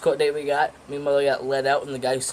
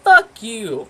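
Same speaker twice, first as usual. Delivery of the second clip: sudden loud, high "Fuck you" at the officers; defiant yell; 8 semitones higher, 9 dB louder than usual. Retold or imitated. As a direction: snap it out as a kid yelling at cops. Cursing at cops offering candy.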